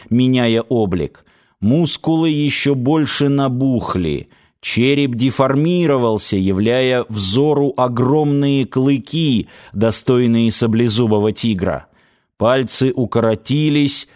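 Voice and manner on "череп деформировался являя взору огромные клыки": plain reading voice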